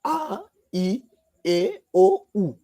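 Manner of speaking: vowels called out one at a time; teacher listing the vowels; reciting the vowels. Shown as a demonstration. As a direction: spell each vowel out slowly for pupils. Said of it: "qui sont".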